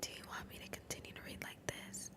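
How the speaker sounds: fully voiceless, hushed ASMR question; whisper. Shown as a demonstration. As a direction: ask it all in a soft whisper. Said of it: "One person said whisper".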